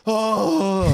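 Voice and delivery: high imitated "ohhh" of dismay; drawn-out high kiddie groan; disappointed kids' groan. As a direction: mimic children groaning at bad news. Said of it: "And they went".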